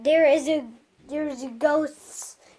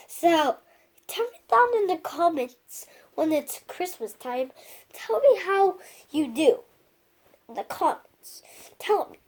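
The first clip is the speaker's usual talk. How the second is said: whispering; 3 dB quieter than usual. Real, not acted. Whispered request to viewers.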